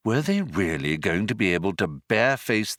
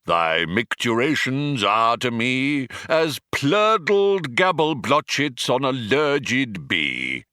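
Vogon poetry reading; 4 semitones higher than usual. Acted. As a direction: declaim the foul verse with relish. Vogon reads his poem.

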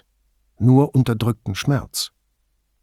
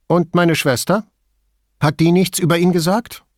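higher woman's voice, guarded; terse middle-aged woman, wary; Signora Toso voice; 8 semitones higher, 5 dB louder than usual. A narrator's reading.

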